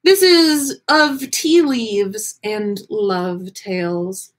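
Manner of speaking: ordinary delivery, nothing marked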